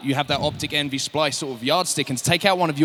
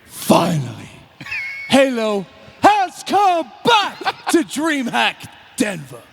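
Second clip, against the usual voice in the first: loud, slow, drawn-out wrestler shout; The Rock voice; 8 semitones higher, 4 dB louder, much slower than usual. Acted. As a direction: boom it out like a wrestling promo. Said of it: "do you want my rock impression".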